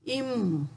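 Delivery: repeating the sound "Im." for beginners; Tamil letter sound. Shown as a demonstration. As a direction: offer "Im." again for the class to copy. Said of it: "im bunyinya".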